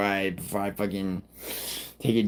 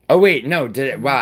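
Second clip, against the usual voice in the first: higher and louder, caught off guard; sudden realization; 5 semitones higher, 7 dB louder than usual. Real, not acted. Streamer realizing he forgot Scotland, surprised. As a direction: blurt it out as you remember.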